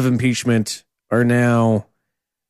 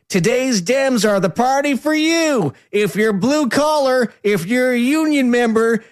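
Ted Cruz; 11 semitones higher than usual. Acted. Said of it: "Ted Cruz, uh, came back".

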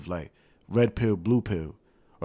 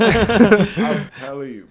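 laughing; 11 semitones higher, 13 dB louder, much slower than usual. Real, not acted. Laughing at the Tyson joke.

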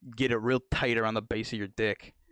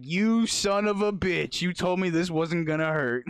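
mock furious patient; 6 semitones higher, 4 dB louder than usual. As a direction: spit it out like a betrayed tough guy. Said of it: "he's just like".

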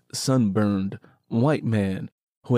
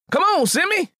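high, loud eager shout; called out; 4 dB louder than usual. Acted. Willie calling his cousin to head home, high shout. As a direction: call him over, much higher and louder. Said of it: "Willie called".

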